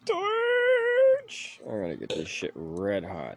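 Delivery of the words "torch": high shout for the torch; calling out. Real, not acted. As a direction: yell it high across the room.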